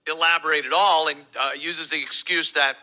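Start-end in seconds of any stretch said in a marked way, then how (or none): none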